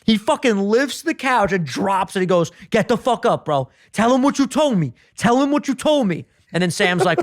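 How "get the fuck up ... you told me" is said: higher-pitched mock demand; retold angry confrontation. Ronnie confronting Mike after lifting the couch, mock angry. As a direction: act out an angry man demanding answers.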